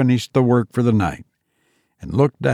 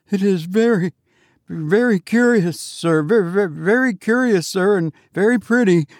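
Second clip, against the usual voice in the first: high, stammering, anxious politeness; frightened sexton; 7 semitones higher than usual. A narrator's reading. Sexton half dead with fright, stammering high. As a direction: stumble over the words in a high voice. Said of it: "half dead with fright".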